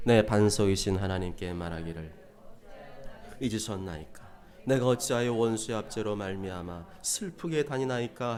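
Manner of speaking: low, level verse reading; scripture reading. Acted. Preacher reading verse nine with the congregation, solemn. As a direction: read the psalm out low and solemnly.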